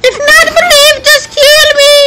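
shrieking meme line, very high; high screech. Acted. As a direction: screech it in a squeaky voice. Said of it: "same meme line, screamed".

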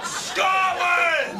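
defiant battle cry. Acted. Trapped man rallying against the lift, defiant shout.

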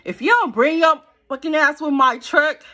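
loud, high furious demand; angry yell. Retold or imitated. Woman yelling at her boyfriend over the phone, furious. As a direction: snap at him to bring the truck back. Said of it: "I'm like".

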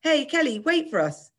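high, loud call after someone. A narrator's reading. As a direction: call out to catch a friend up. Calling after running girl.

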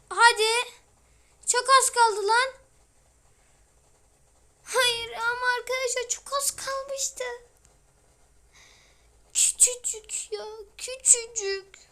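high, loud, slow whine; excited frustration. Real, not acted. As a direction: wail that it was so close. Almost made it, frustrated.

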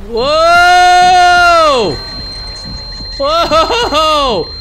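a loud, high, drawn-out whoa; a real fright, yelled out high and loud; startled yell. Real, not acted. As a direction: yell out at the jump scare. Huge jump scare.

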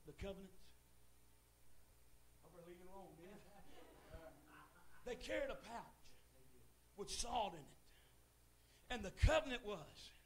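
normal talking voice

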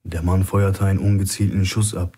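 ordinary delivery

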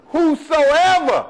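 "Whosoever" said slow and high; stressed whosoever. Shown as a demonstration. Preacher driving home the key word, slow high "Whosoever". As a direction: stretch out "Whosoever" and lift it. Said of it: "was the emphasis".

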